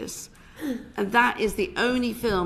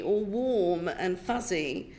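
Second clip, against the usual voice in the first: usual speaking voice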